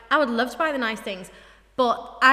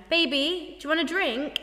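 higher-pitched mock-American "baby"; American accent; 5 semitones higher than usual. Acting out asking a boyfriend at a party, American accent. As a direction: put on a light American accent. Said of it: "you were saying it in American accent".

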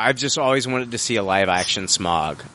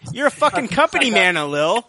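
higher and louder mock accusation; loud teasing; 10 semitones higher, 3 dB louder than usual. Real, not acted. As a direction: call him out, pitched up and louder. Teasing co-host, repeated louder.

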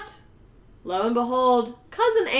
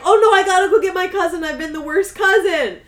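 mock panic; 5 semitones higher, 5 dB louder than usual. Voices his sudden panicked realisation.

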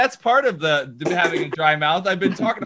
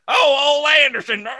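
loud, high, hammed-up country accent; 8 semitones higher, 4 dB louder than usual. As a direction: holler it in a mock country accent. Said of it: "make you do a country accent".

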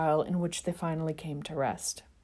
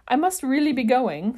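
loud, higher protesting yell; 7 semitones higher, 6 dB louder than usual. Acted. Sirius yelling at the friends dragging him. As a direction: shout it while being dragged. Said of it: "Sirius yelled".

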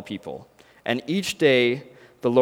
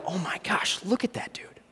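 breathy awed whisper, high and hushed; excited whisper; 5 semitones higher, 10 dB quieter than usual. Acted. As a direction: whisper it so he won't hear. Friend whispering about the athlete.

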